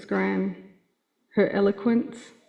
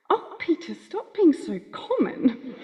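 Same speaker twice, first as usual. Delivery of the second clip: fond, mock-prim telling-off of Gramps; disgust and affection; 7 semitones higher than usual. Gran scolding Gramps Peter, high, prim and fond. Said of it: "mix of disgust and affection".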